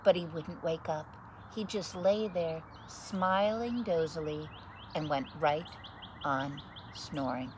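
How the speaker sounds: put-on lively read-aloud voice for children; story narration. Reading a picture book to children, lively story narration. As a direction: read it like bedtime story time.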